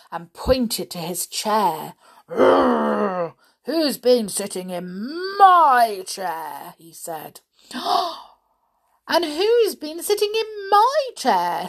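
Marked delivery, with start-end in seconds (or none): 2.3-3.3 long rolling growl; angry bear growl. A narrator's reading. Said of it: "gave an angry growl". 3.7-6.8 loud, slow bear growl with a drawn-out MY; angry father bear. A narrator's reading. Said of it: "gave an angry growl". 7.7-8.2 sharp theatrical gasp; shocked gasp. Acted. Reacting as the bears find chairs. 9.1-11.7 high, loud indignant mother bear. A narrator's reading. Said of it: "said the mother bear".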